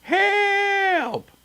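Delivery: high, loud cries of "Help!"; shouting for help. A narrator's reading. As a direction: scream for help while running in panic. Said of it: "running through the village shouting".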